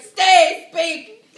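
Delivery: high, very loud theatrical shout; commanding cry. Acted. Hamlet calling to the ghost, commanding shout. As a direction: call desperately after someone leaving.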